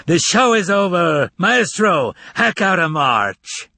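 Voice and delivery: a showman's roar to end the show. Acted. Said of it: "roared out to the theater".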